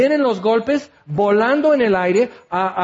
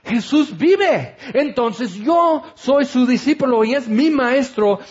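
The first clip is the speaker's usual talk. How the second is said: joyful proclamation; 5 semitones higher than usual. Real, not acted. Preacher proclaims the resurrection.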